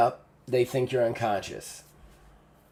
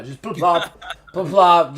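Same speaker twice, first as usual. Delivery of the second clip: laughing; 6 semitones higher, 7 dB louder than usual. Real, not acted. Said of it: "game master miming dead weight".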